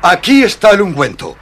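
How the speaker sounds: proud, loud offer to his friend; triumphant. Acted. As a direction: announce it like a victory. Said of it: "con aire triunfante".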